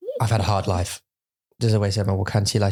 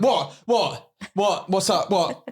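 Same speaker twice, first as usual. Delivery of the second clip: mock panicked; 8 semitones higher, slower than usual.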